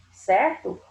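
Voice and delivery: normal talking voice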